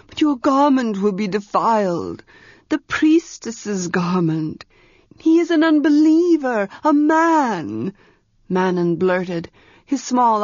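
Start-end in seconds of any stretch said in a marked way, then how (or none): 0.1-7.9 Manon's objection. Acted. Scandalised servant Manon objects to the priestess's cloak. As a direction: blurt it out in dismay.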